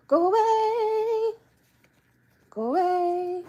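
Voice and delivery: high, slow sing-song go away; half-joking rising rage at the dog; high drawn-out go away; mock rage. Real, not acted. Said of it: "I was all zen, and I'm about to rage".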